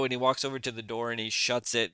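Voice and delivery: ordinary delivery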